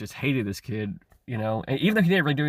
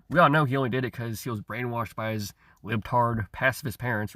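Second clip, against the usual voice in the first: ordinary talk, nothing marked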